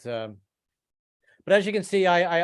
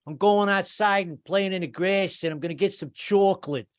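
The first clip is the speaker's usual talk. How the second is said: put-on Jersey accent; 6 semitones higher than usual. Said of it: "I started saying things like".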